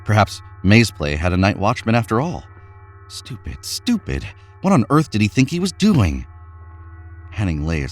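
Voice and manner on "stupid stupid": usual speaking voice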